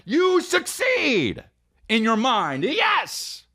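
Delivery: very high, drawn-out squeal; exultant proclamation. Acted. Comic preaching success to his listeners, exultant high squeal. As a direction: stretch it out in a high triumphant cry. Mock motivational rant, drawn out.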